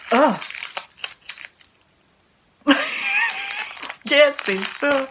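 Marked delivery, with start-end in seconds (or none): 2.6-4.0 shrieking laugh. Real, not acted. Opening mystery snack.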